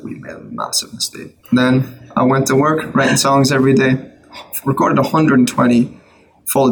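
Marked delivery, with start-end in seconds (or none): 0.0-1.4 whispered inner thought. Retold or imitated. Inner thought, voiced under his breath.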